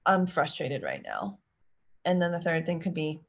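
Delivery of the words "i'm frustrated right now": slow, steady naming of the feeling; calm naming. Shown as a demonstration. Parent modeling emotion naming, slow and calm. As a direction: say it slowly and plainly, owning it. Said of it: "and naming the emotion".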